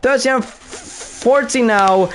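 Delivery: high, fast excited race call; excited commentary. Real, not acted. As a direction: call the chaos with rising excitement.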